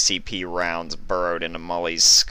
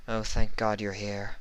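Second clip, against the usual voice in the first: weary mumble; 3 semitones higher, 3 dB quieter than usual. A narrator's reading. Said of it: "she mumbled wearily".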